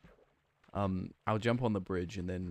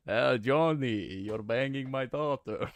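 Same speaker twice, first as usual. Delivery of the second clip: slow, loud mock vampire with a muscleman accent; Dracula-Arnold mashup; 4 semitones higher, 6 dB louder, slower than usual. Streamer jokes to chat as a slow loud Dracula-Arnold voice. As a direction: ham up a Transylvanian count crossed with Arnold. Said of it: "mix Dracula from Hotel Transylvania with Arnold".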